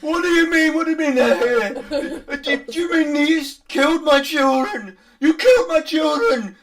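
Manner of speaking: high, screamed disbelief; loud, distraught, near-crying shout; anguished accusation. Acted. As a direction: scream at them as a grief-crazed father. Grieving father accuses the party.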